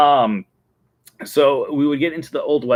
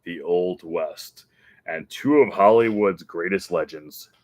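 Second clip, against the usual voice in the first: slow, grand announcer voice; lightly voiced movie trailer narrator; ride narrator; 4 semitones lower, slower than usual. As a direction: draw out the intro like a show host. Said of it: "the narrator would be something like".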